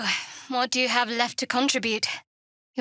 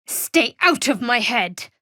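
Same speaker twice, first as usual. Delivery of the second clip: slow, loud furious yell; shouted, slow and forceful; angry shout; 7 dB louder, slower than usual. Acted. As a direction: shove the intruder out of your mind. Fighting off mental intrusion.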